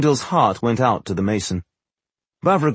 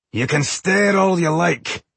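slow, high taunting dwarf; gruff dwarf voice; 7 semitones higher, slower than usual. A narrator's reading. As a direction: drawl it out at him, slow and high. Gruff dwarf mocks a glare.

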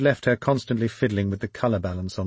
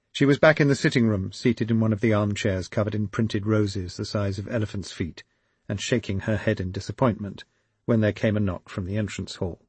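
ordinary delivery

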